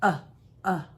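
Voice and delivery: uh, uh as a short open u like up; letter sound demo. Shown as a demonstration. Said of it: "The U says".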